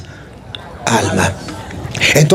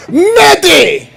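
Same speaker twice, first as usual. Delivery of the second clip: loud, high shout of get out; shouted order; 11 semitones higher, 3 dB louder than usual. Retold or imitated. Priest shouts at the catechist to leave. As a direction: bark her out the door.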